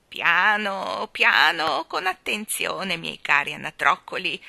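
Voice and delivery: put-on loud, slow, quavering old balloon seller; trembling old woman. Balloon woman chides the children, in a loud trembling old voice. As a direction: scold them like a frail old woman full of passion. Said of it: "li ammonì".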